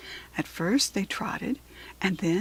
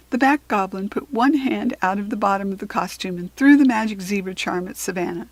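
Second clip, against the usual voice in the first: usual speaking voice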